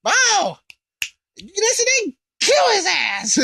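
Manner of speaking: very high, shrill shouted order; bossy Babidi. Acted. Babidi ordering Buu to kill Kai, high shouting command. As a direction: bark it like a spoiled little master.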